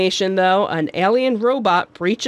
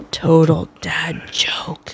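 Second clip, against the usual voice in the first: whispered; 3 semitones lower, slower than usual. Real, not acted. Reacting to a pun.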